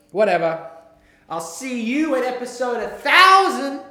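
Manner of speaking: loud, high yelling sign-off; screaming. Real, not acted. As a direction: yell it out carelessly. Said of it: "Stop screaming".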